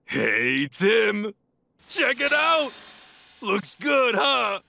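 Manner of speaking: proud detective shouting to his partner; excited showing off. Acted. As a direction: call out to Tim, thrilled with yourself. Pikachu shows off disguise.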